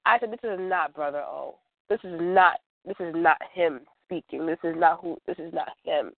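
usual speaking voice

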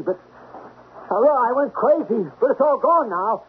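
high, pleading calls after someone running off; calling after her. Acted. Man calling the fleeing Dory back, high desperate pleading.

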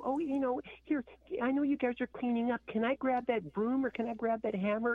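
high, breathy-free valley girl put-on; mock a fake-caring Instagram model; fake influencer voice. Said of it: "so they're all".

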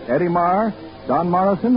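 normal talking voice

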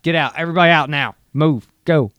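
loud, forceful mock command; acted evacuation order. Host acting out officials clearing the place out, loud commanding. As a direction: order everyone out, punching each short command.

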